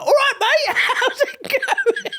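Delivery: chirpy sunny-day hello; squeaky laughing mate voice. Shown as a demonstration. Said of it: "That's my sunny voice".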